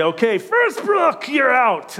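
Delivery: high, loud mock coach's shout. Coach pulling the boy off the field, high mock shout. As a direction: call the player off like a Scottish coach. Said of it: "And he would say".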